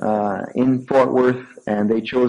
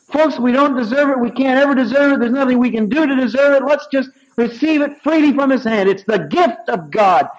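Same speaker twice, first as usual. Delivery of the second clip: high, louder preaching climax; preaching at full force; 11 semitones higher, 3 dB louder than usual. Acted. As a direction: press the point home to the congregation.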